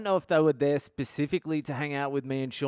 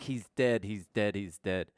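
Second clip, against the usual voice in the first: sad; 4 semitones lower, 3 dB quieter than usual. Retold or imitated. Girl reporting death, distraught.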